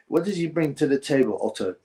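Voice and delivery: ordinary talk, nothing marked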